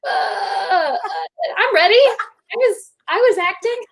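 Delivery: high-pitched mock-timid stammer; flustered protest. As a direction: squeak out a sheepish excuse, hammed up. Acts out her flustered reply.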